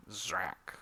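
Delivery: hushed zap noise; comic sound effect. Acted. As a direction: whisper the spell blast.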